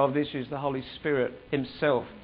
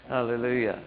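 ordinary delivery

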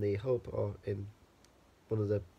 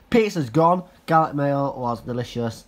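ordinary talk, nothing marked

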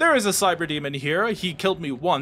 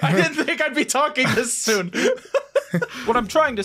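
laughing, higher, caught off guard; 6 semitones higher than usual. Real, not acted. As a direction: break into embarrassed laughter mid-sentence.

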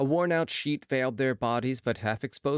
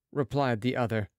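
usual speaking voice

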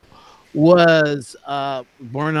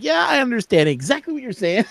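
higher-pitched Irish lilt; Irish accent; 7 semitones higher than usual. Retold or imitated. Answering friends who speak Irish, in an Irish accent. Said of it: "then they'll start speaking Irish".

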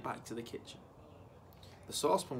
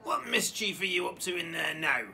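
put-on higher, suspicious old grandma screech; screeching granny; 4 semitones higher, 4 dB louder than usual. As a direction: screech at the boy like a mean grandma. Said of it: "Granny screeched".

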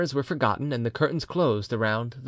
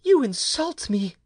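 high, slow wounded wife; offended wife; 10 semitones higher, slower than usual. A narrator's reading. Wife rebukes her husband for insulting her, slow and high. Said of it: "said Bertrande".

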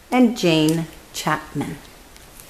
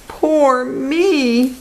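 mock wail; 6 semitones higher than usual. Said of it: "he wails".

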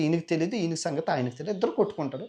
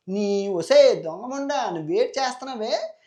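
louder and higher, furious yelling at his sister; enraged roar; angry shout; 7 semitones higher, 6 dB louder than usual. Acted. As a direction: brother bellowing at his wayward sister. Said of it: "ఆ అరుపు చూడగానే".

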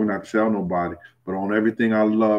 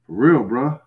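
ordinary talk

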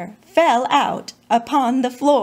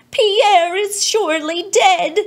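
loud, higher wail of alarm; cried out in alarm; 8 semitones higher, 6 dB louder than usual. Acted. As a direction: parents shocked at the sick lion, crying out loud in alarm. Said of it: "and cried".

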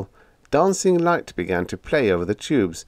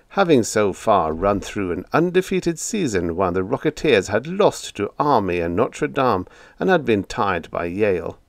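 plain reading voice

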